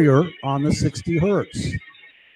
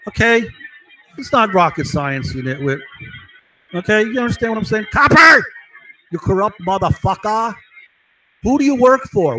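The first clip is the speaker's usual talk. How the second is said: angry shout; 5 semitones higher than usual. Real, not acted. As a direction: yell at him in real rage. Yelling at a cop.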